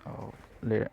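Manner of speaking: ordinary delivery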